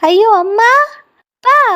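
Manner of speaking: put-on frightened child's rising cry for mum and dad; panicked call. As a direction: call out in panic, voice jumping up on அப்பா. Lost child calling parents. (பயந்து அலறும் குழந்தை)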